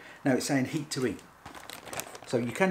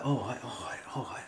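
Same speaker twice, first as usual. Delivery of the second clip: whisper; 4 dB quieter than usual. Pretend. Nervous agreement to Heston.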